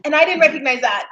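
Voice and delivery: usual speaking voice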